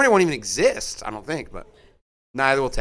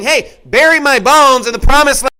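higher, louder confident last wish; quoting Jacob with conviction; 6 semitones higher, 8 dB louder than usual. Retold or imitated. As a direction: proclaim it like a dying patriarch sure of his hope.

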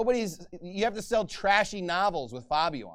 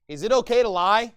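louder, higher indignant challenge; mad question; 5 semitones higher, 8 dB louder than usual. Retold or imitated. Someone mad asking if lying is okay, raised indignant voice. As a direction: demand it like you're fed up. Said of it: "Somebody might be mad".

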